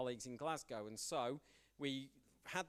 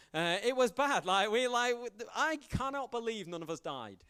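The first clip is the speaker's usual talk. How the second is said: much higher and louder, incredulous; amazed disbelief; 8 semitones higher, 10 dB louder than usual. Real, not acted. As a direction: blurt it out, still stunned. Retelling a near-disaster, amazed.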